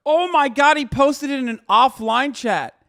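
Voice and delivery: loud, high exclamation of disbelief; incredulous cry, well above normal voice; real shocked outburst. Real, not acted. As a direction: blurt it out, then stretch the words. Startled reaction interrupting his reading.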